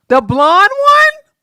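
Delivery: high, loud, slow incredulous question; astonished. Real, not acted. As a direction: drag it out in disbelief. Amazed at her third appearance.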